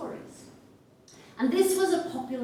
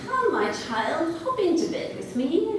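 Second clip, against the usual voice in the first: wolf posing as grandmother; 8 semitones higher than usual. Acted. Wolf in bed lures the girl, high coaxing granny voice. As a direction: coax in a raised fake-granny voice. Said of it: "the wolf said".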